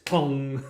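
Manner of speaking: sound effect. Pretend. Sound effect for balance gesture.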